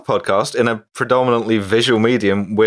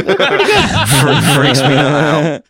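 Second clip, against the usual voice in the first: slow, louder mock Cockney; Cockney geezer voice; 5 dB louder, much slower than usual. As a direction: ham up an East End lad. Repeating the catchphrase.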